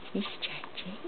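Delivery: soft, almost under the breath; hushed narration. Real, not acted. Mother watching her baby stretch, hushed. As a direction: say it low so not to disturb him.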